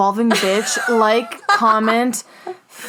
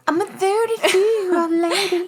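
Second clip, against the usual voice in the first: mock grown-up lady; 9 semitones higher than usual. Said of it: "You're like".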